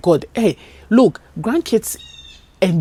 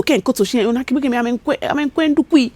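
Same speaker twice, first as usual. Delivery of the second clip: ordinary delivery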